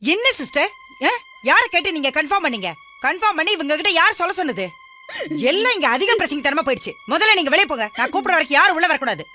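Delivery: high, indignant reprimand; angry scolding. Acted. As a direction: tell off a subordinate sharply. Doctor scolds nurse, orders her out.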